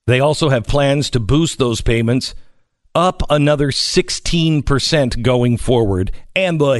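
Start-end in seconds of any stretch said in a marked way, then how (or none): none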